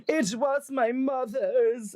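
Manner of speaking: high, drawn-out mock-tearful wail; hammed-up weepy excuse; fake crying. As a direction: feign being overcome with emotion. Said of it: "get really emotional and cry".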